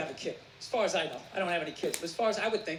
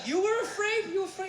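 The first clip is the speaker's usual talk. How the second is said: incredulous son; 7 semitones higher than usual. Retold or imitated. Son stunned by mother.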